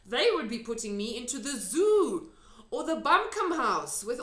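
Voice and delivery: the giant ranting about being caught; lively big friendly giant; excited giant. A narrator's reading. Giant's long speech.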